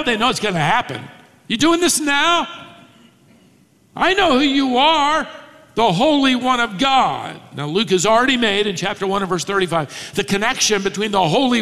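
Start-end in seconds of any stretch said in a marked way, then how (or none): 1.5-7.4 agitated demon. Retold or imitated. Demon paraphrase.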